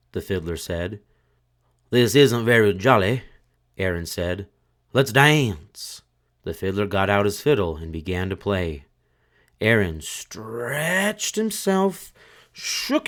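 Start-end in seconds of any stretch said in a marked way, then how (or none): 1.9-3.2 louder jolly corpse; dead man's voice. A narrator's reading. Dead man to the couple, louder. 4.9-6.0 louder jolly corpse; dead man's voice. A narrator's reading. Dead man to the couple, louder. 9.6-13.1 very slow, higher stretched words; stretched narration. A narrator's reading. Narrator acting the corpse stretching, very slow.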